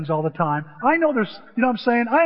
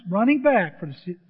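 ordinary delivery, nothing marked

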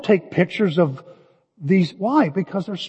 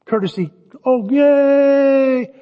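half-hearted cheer; 5 semitones higher, slower than usual. Retold or imitated. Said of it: "I go".

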